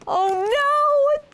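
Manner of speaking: a big, high, hammed-up "oh no" for the kids; mock surprise. A narrator's reading. Snow falls on Peter.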